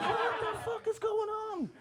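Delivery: hammed-up baffled cry; mock bewilderment. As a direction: squeal it in total confusion. Bewildered onlooker voiced.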